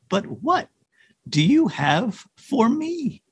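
slow, high little plea; pleading reindeer. A narrator's reading. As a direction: draw out the question with pauses.

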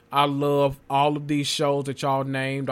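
ordinary talk

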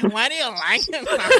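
high whiny jealous mimicry. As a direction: whine it like a jealous girlfriend. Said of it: "Look at her say it in a whiny, jealous way".

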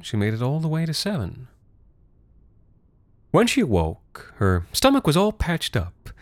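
ordinary delivery

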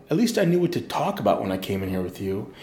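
normal talking voice

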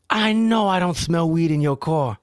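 slow, high, accusing; cop's challenge. Retold or imitated. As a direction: imitate an indignant cop, drawn out. Acts the cop challenging the driver.

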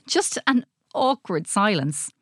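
usual speaking voice

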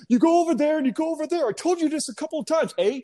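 very high, fast, put-on scolding; mock angry shouting. Fed-up passerby to lost driver, high mock yelling. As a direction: imitate an annoyed local yelling back. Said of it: "shouting back at me".

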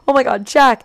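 high, loud retold greeting; acted-out excited recognition; relieved surprise. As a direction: call out on spotting a lost friend. Acts out spotting lost friend.

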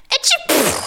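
tiny sneeze, very high pitched; a small dainty achoo; high squeaky sneeze. Shown as a demonstration. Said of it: "I was expecting".

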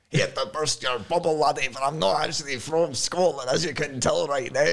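exaggerated Scottish patter; terrible Scottish accent. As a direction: put on a bad Scottish accent. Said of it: "Scottish accent".

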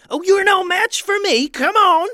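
very high, loud indignant outburst; outraged villain. A narrator's reading. Ketchup-covered Hambone confronting the sisters, outraged. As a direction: yell it in a high cartoon villain voice.

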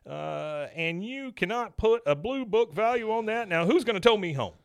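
high-pitched, wounded indignation; hammed-up insistence; adamant. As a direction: protest stubbornly, acting out an affronted truck owner. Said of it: "he's adamant".

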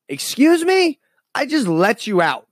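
shocked rescuer snapping back; indignant excuse me. Acted. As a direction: act outraged at the ungrateful prisoner.

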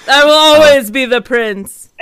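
loud, proud stage declaration of a prince; grand final line. Acted. Actor closing the play reading, loud and proud.

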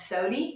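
sody ending in a long ee; old Appalachian pronunciation. Shown as a demonstration. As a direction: say soda as SO-dee. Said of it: "change it to a Y".

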